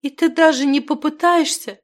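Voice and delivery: high, loud tearful reproach. A narrator's reading. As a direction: plead in disbelief through tears. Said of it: "заливалась слезами девушка".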